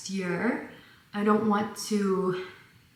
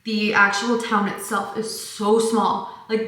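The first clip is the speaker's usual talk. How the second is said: normal talking voice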